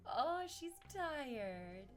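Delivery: ordinary talk, nothing marked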